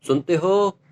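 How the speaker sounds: loud call across the house; calling out to dad. Retold or imitated. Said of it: "mummy ने एक बार बोला". (पापा को ज़ोर से पुकारना)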